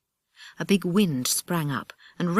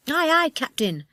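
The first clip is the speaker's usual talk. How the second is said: put-on high, eager children's reply; joyful shout; 11 semitones higher, 4 dB louder than usual. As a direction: call it out with delight. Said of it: "shouted the children joyfully".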